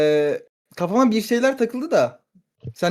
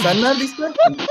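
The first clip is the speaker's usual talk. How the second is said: laughing; 7 semitones higher than usual. Real, not acted. Losing it during roleplay.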